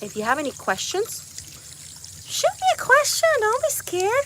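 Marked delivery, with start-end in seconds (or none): 2.3-4.2 playful coaxing. Real, not acted. Coaxing viewers, pitch jumps.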